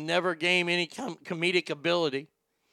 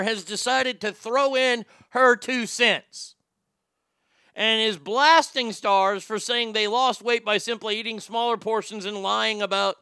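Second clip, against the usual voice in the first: ordinary talk, nothing marked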